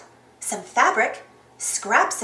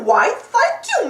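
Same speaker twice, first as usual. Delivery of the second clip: Clarabelle voice; 9 semitones higher, 4 dB louder, slower than usual. A narrator's reading. Clarabelle grateful.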